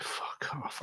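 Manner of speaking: whispered under the breath; whispered disbelief. Retold or imitated. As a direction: breathe it out in amazement. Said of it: "and then I was like".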